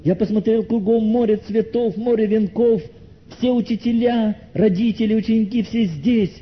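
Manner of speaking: high, sustained list building the funeral scene; impassioned sermon storytelling. Acted. Brother describes the crowded funeral, impassioned telling.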